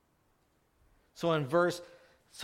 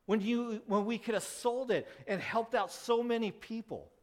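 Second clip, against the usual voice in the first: higher, complaining protest; indignant disciples; 7 semitones higher than usual. Retold or imitated. Disciples complain to Mary about the poor, mildly raised indignant voice. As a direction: press the why like grumbling disciples. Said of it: "They're going".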